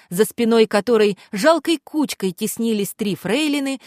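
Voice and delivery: usual speaking voice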